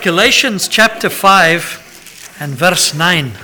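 usual speaking voice

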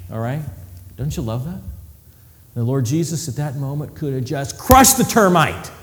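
ordinary talk

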